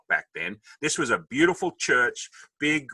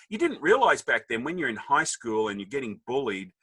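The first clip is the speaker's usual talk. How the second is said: ordinary talk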